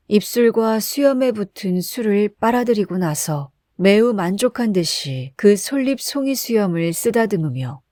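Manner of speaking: ordinary delivery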